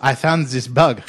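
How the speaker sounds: slow, hammed-up French accent. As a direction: put on a comic French accent, drawn out. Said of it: "do this in a French accent".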